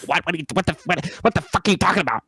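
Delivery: high mock outraged boss; a hammed-up boss losing it; imitating angry boss. Boss snapping at a new worker who asks for help, mock outraged.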